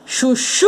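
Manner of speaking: "chuchu" called out, last syllable hugely lengthened; calling the word. Shown as a demonstration. Teacher calling "chuchu" to find its stressed last syllable, drawn out. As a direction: call "chuchu" like a name, holding the final "chu". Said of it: "nós vamos precisar chamá-las".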